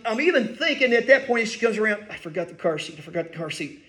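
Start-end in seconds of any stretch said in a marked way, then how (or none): 2.1-3.8 low, hushed retold panic; inwardly panicking. Husband realizing his slip as wife approaches, hushed mock panic. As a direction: think it aloud softly, in mock panic. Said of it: "I'm panicking".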